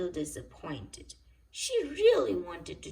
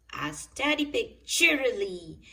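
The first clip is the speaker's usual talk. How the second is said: plain reading voice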